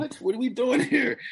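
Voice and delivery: can't believe this; incredulous. Real, not acted. Host reacting to the home run record, high and incredulous. Disbelief at baseball record talk.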